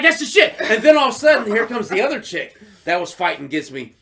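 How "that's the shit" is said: high, loud thrilled cry; delighted. Retold or imitated. Praising the rival who moved the bodies, delighted. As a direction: cheer it out.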